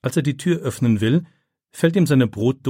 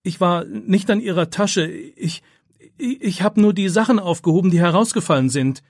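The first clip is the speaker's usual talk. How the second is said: scared protest; 8 semitones higher, 4 dB louder than usual. A narrator's reading. Boy accused, defends himself.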